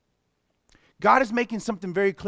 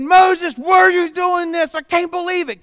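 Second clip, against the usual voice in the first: high, loud furious outcry at Moses; angry crowd shout; 10 semitones higher, 10 dB louder than usual. Acted. Angry congregation shouting at Moses, high and loud. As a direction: yell it as the grumbling crowd. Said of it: "got so angry and said".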